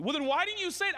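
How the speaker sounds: high, loud exasperated Joe; hammed-up shout at Mary; mock yelling. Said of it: "Why are you yelling at me?".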